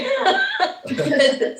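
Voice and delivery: high, soft giggle; a breathless high laugh; embarrassed happy giggle. Real, not acted. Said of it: "I'm happy".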